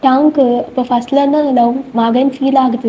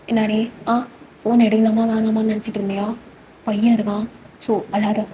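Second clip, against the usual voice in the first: put-on softer, hushed phone talk so the boy won't hear; hushed soothing; 2 semitones lower, 6 dB quieter than usual. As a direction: keep it down and calm her. Husband soothing crying wife on phone.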